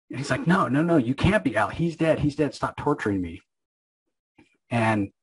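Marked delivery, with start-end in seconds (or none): 0.5-3.4 higher-pitched pleading that falls away; retold distraught denial. Wanda refusing to believe Al is back, mock distraught. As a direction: act out panicked disbelief, lightly.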